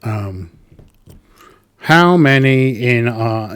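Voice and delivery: usual speaking voice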